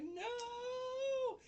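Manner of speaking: very high, loud shrill no; shrieked refusal. Retold or imitated. Retold teacher refusing her colleague with a high shriek. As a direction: shriek it like she's horrified.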